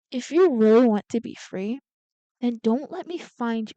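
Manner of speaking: loud, forceful warning; loud threat. Acted. Double warning Winnie not to be found, loudly threatening. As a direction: deliver the threat at full volume. Villain threatens his ex, first take.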